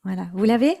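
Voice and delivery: ordinary talk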